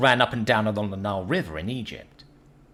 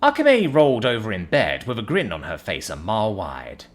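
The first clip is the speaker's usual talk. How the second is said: usual speaking voice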